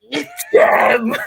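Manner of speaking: high laughing shout; laughing shriek. Real, not acted. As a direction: squeal it through laughter.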